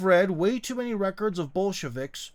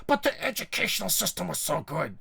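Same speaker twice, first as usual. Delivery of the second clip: mocking a commenter; 3 semitones higher, 3 dB quieter than usual. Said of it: "things they always say about communism".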